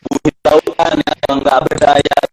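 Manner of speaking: glitchy distorted line; robot voice. Real, not acted. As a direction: voice breaking up like a robot. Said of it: "suaranya seperti, apa, pemain Robocop".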